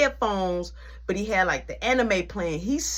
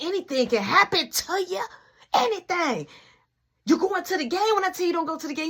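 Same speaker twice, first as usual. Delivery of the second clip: high, pleading scolding grandma; anguished grandma; 9 semitones higher than usual. Retold or imitated. Nana fearing for her grandson, high and anguished. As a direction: scold him out of fear for him.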